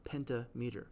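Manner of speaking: "pentameter" split into PEN-ta and MEE-ter; as spelled. Shown as a demonstration. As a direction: say "pentameter" as two words, penta-meter. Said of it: "but it looks like pentameter".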